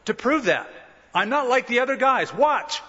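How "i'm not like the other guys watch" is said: high-pitched cocky boast; self-confident. Acted. Peter out to prove himself with the sword, self-confident. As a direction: show off, set on proving it. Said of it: "Peter was self-confident".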